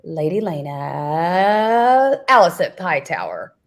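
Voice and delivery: drawn-out mock award reveal; hammed-up winner announcement; excited announcer. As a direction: stretch the name into a slow climb, then drop. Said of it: "He's so excited".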